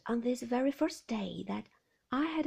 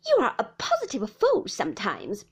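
loud, scornful scolding; raised-voice rebuke; angry; 9 dB louder than usual. A narrator's reading. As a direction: scold her forcefully. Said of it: "rising angrily".

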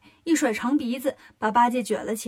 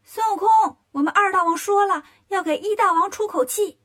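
high, loud messenger's call; little demon shouting; 7 semitones higher, 4 dB louder than usual. A narrator's reading. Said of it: "小妖跑过来喊".

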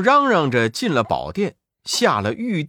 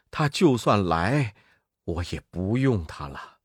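put-on weary old monk; 7 semitones lower, 6 dB quieter than usual. Tang monk sends Pigsy off.

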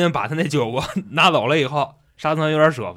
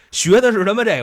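ordinary talk

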